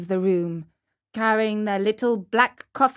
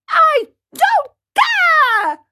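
very high, loud, dragged-out yell; bratty child at full volume; defiant shriek; 7 dB louder, much slower than usual. Acted. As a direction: scream each word slowly in a tantrum. Puppet boy defies the fairy louder.

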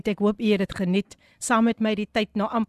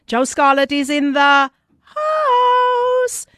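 louder, slow, drawn-out DJ shout; hype shout; 4 semitones higher, 8 dB louder, much slower than usual. Acted. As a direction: shout the name like a big entrance. Host shouting out a listener.

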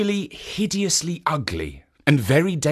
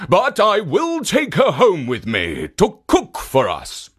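ogre voice; 6 semitones higher, 5 dB louder than usual. Acted. Ogre answers.

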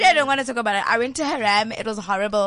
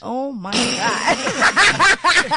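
slow laughing groan; 5 dB louder, much slower than usual. Real, not acted. Laughing at her bad night.

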